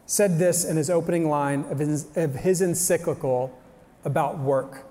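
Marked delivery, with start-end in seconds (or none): none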